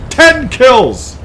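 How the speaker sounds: high, loud exclamation; triumphant cheer. Real, not acted. Player celebrating ten kills, triumphant cheer. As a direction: announce it as a loud, high victory cry.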